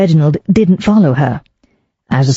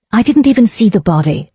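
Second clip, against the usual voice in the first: snapped; 6 semitones higher than usual. Acted. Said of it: "Daisy snapped".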